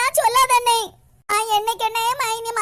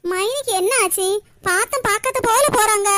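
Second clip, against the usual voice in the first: ordinary delivery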